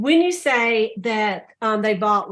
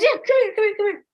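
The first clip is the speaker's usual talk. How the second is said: very high, imitated ladies beckoning; the retold ladies calling him to the table; eager calling over; 12 semitones higher, faster than usual. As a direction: wave someone over with mock urgency.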